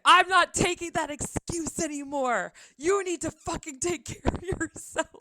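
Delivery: mock telling-off, firm and fed up; a friend laying down the law, half-joking; scolding friend. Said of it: "And she's like".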